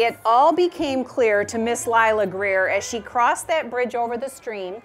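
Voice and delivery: reading a rhyming picture book aloud; gentle storytime reading voice; story narration. A narrator's reading. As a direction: read it like storytime for a class.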